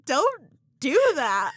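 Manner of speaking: firm refusal. Retold or imitated. Telling her ex to stop groping, loud slow refusal. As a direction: push him off with your voice.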